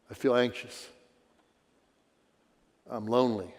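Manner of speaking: a troubled person admitting fears, quietly; voicing someone's pain. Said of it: "delivers that kind of pain".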